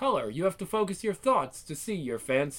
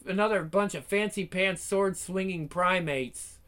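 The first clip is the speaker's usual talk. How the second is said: usual speaking voice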